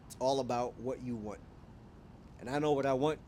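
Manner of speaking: ordinary talk, nothing marked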